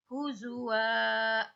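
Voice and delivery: "huzwa" slowly with a held "waa"; slow recited "huzwa", final vowel long. Shown as a demonstration. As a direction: stretch "huzwa" at the end. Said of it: "Wa-nya dipanjangkan".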